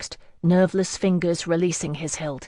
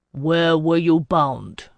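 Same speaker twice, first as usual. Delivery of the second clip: slow, clipped-back annoyance; annoyed; 4 dB louder, slower than usual. Acted. Lord questioning the wandering girl, slow and annoyed. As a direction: let the irritation show in a slow question. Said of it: "plainly annoyed".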